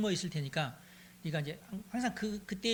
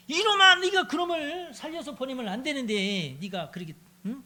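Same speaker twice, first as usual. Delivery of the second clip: higher, louder raging father; furious shout; 7 semitones higher, 6 dB louder than usual. Acted. King scolding his son for sparing David, furious shout. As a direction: roar at the son who let him go.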